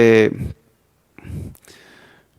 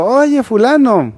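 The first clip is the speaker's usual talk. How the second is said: calling out; 7 semitones higher, slower than usual. Retold or imitated. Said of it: "y le grito".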